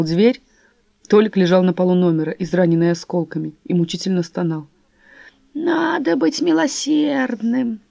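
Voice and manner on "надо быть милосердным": put-on high, slow creaky old voice; creaky old man. A narrator's reading. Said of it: "проскрипел старик".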